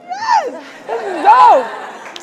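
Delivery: high, drawn-out cry of delight; elated reaction. Retold or imitated. Director getting the script back, elated. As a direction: celebrate high, stretching the last word.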